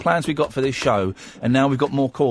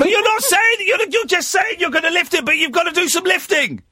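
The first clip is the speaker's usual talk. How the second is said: mock exasperated. Real, not acted. Host mock-scolds caller.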